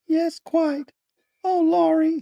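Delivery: put-on very high falsetto girl's voice; emotional young girl. A narrator's reading. Laura taking her brother's arm, girlish falsetto.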